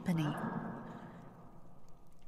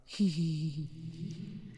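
soft giggle; 5 dB quieter than usual. Acted. Otherworldly being amused at the human's mistake, soft titter. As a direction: titter quietly at the mortal's mistake. Entity amused mid-reveal.

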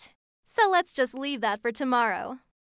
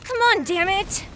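angry gamer yelling; 7 semitones higher, slower than usual. Real, not acted. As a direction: rage at losing the game. Losing a video game, raging.

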